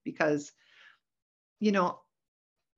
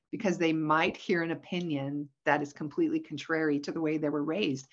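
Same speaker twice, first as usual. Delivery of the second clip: ordinary talk, nothing marked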